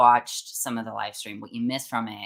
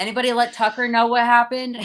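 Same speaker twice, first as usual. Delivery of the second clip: raised, higher voice, taunting out loud; loud mocking taunt; 11 semitones higher, 6 dB louder than usual. Taunting protesters aloud as she is escorted out, loud mock questions. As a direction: heckle them loudly on purpose. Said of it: "I was, like, loudly saying".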